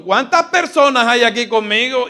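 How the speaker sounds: high, loud, fast call to the crowd; rousing question. Pretend. Pastor rallying the congregation with a question. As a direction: fire up the congregation. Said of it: "Dígalo más fuerte".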